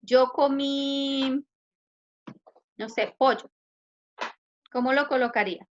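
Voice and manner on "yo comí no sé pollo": very slow, spelled-out yo comí; slow example. Shown as a demonstration. Teacher modelling an example to the class, very slowly. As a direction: lay out yo comí slowly for the students. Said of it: "Si yo digo".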